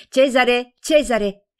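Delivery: loud, high call across the room. A narrator's reading. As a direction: summon Cesare from the next room. Calling her fiancé over.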